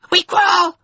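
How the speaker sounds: very high, loud wail; echoing chorus cry. A narrator's reading. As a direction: echo it as a piercing crowd cry. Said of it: "voices echoed".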